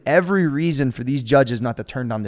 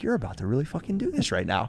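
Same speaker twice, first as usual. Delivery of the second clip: inner voice; 7 dB quieter than usual. Retold or imitated. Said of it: "I was like".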